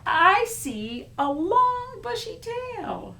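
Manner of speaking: high, slow, loud marvelling; storybook awe; character voice. Acted. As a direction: stretch it out in wonder. Character describes tail.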